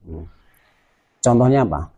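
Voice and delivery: ordinary talk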